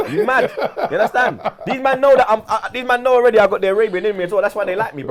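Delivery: laughing while bragging, voice lifted; laughing boast. Real, not acted. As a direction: brag through laughter. Laughing boast about heritage.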